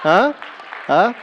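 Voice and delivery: ordinary talk, nothing marked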